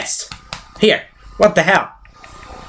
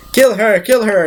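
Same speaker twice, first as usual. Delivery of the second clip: urgent command; 8 semitones higher than usual. Retold or imitated. Repeats the henchman's shouted order.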